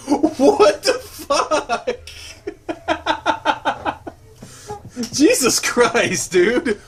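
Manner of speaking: loud, very high squeal of disbelief; helplessly cracking up, words stretched; laughing in shock. Real, not acted. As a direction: shriek it slowly through real laughter. Reader bursts out laughing in shock.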